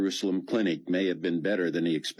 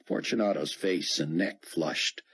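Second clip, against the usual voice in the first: ordinary delivery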